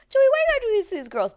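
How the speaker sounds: high fast scolding cry; exasperated outburst. Retold or imitated. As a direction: throw up your hands at Joey. Scolding the bachelor at the TV.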